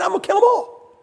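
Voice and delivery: high, loud mock divine threat; God losing patience. God tells Moses he will destroy Israel, high mock threat. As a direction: play God fed up and ready to wipe them out. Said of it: "He said".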